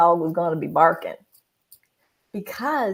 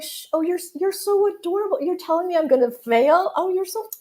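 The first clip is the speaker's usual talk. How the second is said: high cooing voice for a puppy; baby talk to a dog; 9 semitones higher than usual. Retold or imitated. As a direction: coo at a cute little dog. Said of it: "You're like".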